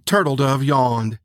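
ordinary delivery

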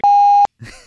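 sudden high loud laugh. Real, not acted. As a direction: burst out laughing mid-sentence.

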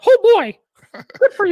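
very high, loud cartoon mouse; Mickey Mouse voice. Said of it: "or like Mickey Mouse".

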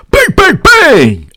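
very high, loud mimicked bangs; high-pitched banging. Fan imitating thunder sticks at security, shrill high chant.

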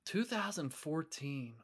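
hushed, drawn-out realization; stunned disbelief. Real, not acted. Co-host realizing how long ago the event was, stunned and hushed. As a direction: let it sink in slowly, under your breath.

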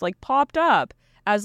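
ordinary delivery

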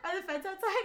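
high-pitched laughing question; mock worry. Guest asked about health code violations, mock worried and laughing. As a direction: feign alarm through a laugh. Joking fear before confessing violations.

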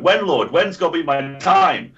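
high, loud pleading cry to the heavens; mock desperate yell. Quoting a film character's pleading yell, high and loud. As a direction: wail it like a man begging for his turn. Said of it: "culminating in him looking at Bob and yelling".

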